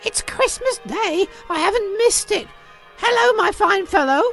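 high, giddy delight; high-pitched, elated calling; overjoyed Scrooge. Acted. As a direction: exclaim in joy and relief. Scrooge rejoices, hails the boy.

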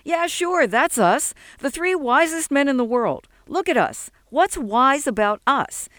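high-pitched scoffing rant; sarcastic tirade. A narrator's reading.

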